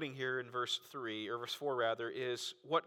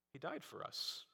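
hushed whisper; 14 dB quieter than usual. Real, not acted. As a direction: whisper it, barely voiced. Preacher drops to hushed climax.

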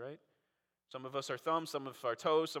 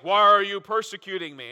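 booming voice from heaven; 3 semitones higher, 12 dB louder than usual. Retold or imitated. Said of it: "you hear this voice".

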